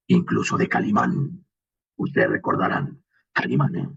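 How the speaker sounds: evoke the classic deep radio hero Calimán; old radio hero voice. Retold or imitated. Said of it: "vienen de referencia desde hace muchos años".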